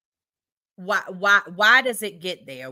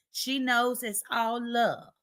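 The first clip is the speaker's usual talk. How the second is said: ordinary talk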